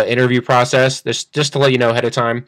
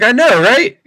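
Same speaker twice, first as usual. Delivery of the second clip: excited agreement; 9 semitones higher, 4 dB louder than usual. Retold or imitated. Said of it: "And then you're like".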